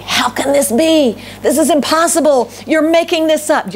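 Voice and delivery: mock the sceptic who calls it impossible; doubting scoffer. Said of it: "And some of us say".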